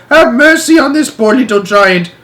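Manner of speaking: high, loud giant begging for mercy; panicked screaming. Acted. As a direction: plead frantically, voice way up. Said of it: "screamed the flesh lump eater".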